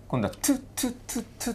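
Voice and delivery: トゥ breathed out softly with no voice; voiceless t demo. Shown as a demonstration. Teacher contrasting the ending sound for students, voiceless t. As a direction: whisper トゥ as a voiceless t. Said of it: "トゥって読む時ね".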